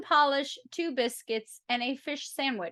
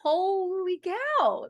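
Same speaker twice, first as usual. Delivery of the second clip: amazed; 6 semitones higher, much slower than usual. Real, not acted. Reacting to chart success.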